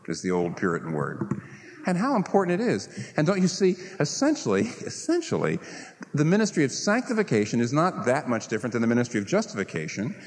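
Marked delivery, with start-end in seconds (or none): 4.6-5.6 softer and lower, laughing through it; preacher laughs at himself mid-sentence. Real, not acted. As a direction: let a laugh break into the word. Laughs while repeating word.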